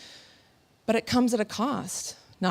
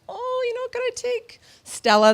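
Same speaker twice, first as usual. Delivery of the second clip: sweetly asking; 5 dB louder than usual. Retold or imitated. Retold sweet request to guide.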